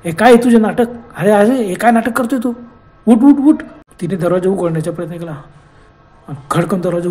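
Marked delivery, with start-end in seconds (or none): 0.0-3.6 building from loud to higher shouted pleading; shouting. Acted. Rupa shouting at lifeless Pratap to wake up. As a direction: shake him awake with rising shouts. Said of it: "ती ओरडली".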